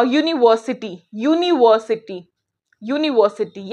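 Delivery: "यूनिवर्सिटी" said with a clear "yu" at the start, slowed a little; sounding out a word. Shown as a demonstration. Teacher to students, demo of "यूनिवर्सिटी" starting with "yu". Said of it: "ऐसे ही मैं बात करूँ".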